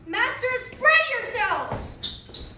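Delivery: loud, slow call to the troupe. Acted. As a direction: bellow it across the room. Calling the actors to assemble.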